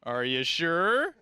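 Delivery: slow, loud, leaning-in question; pushy salesman. Retold or imitated. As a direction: press the customer, drawn out. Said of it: "The other goes".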